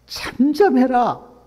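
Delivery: high, slow, scolding hush; rebuking. Retold or imitated. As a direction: shut him up with a stern rebuke. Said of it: "꾸짖으면서".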